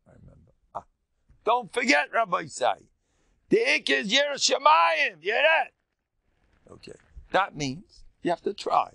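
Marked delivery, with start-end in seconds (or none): 1.4-5.7 high, loud rebbe's warning; a rabbi booming a reminder at him; emphatic shout. Retold or imitated. Rabbi voiced emphatically, retold.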